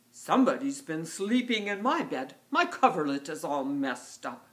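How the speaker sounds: loud, grumbling bear bellow; giant huge bear. Acted. Giant bear finds his bed messed up, loud bellow. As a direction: boom it out as Papa Bear. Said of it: "huge bear says".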